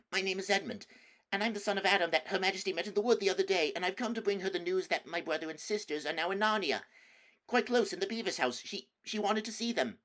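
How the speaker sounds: put-on high, hurried nervous boy's voice; frightened boy. Edmund rushing his explanation to the wolf, nervous. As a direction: babble it out fast to please the wolf.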